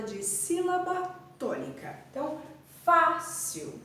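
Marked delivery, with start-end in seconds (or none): 2.8-3.8 "fácil" with FÁ high and punched, the rest dropping; stress on first syllable. Shown as a demonstration. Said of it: "Fá é a sílaba tônica". ("fácil" com a primeira sílaba tônica bem forte)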